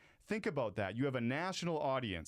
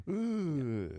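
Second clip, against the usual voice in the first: a hint of a pained oof, a little louder; mock dismay; 3 semitones higher, 4 dB louder than usual. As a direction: let it sink in with a groan. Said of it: "but then it's like".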